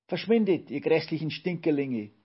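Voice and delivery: high, loud threatening cry; acted screech. A narrator's reading. Cleaning witch threatens the Olchi children, screeching. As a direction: screech it to drive them away. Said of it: "drohte mit dem Besen und kreischte".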